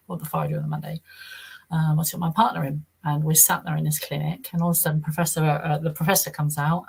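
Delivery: ordinary talk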